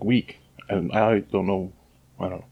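usual speaking voice

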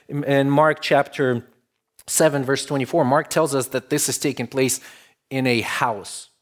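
ordinary delivery

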